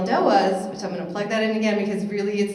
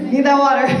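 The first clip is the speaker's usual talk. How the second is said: much higher and much louder, still laughing; 8 semitones higher, 13 dB louder, much faster than usual. Real, not acted. Trainer laughing as she repeats the need for water, high and loud. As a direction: laugh the words out.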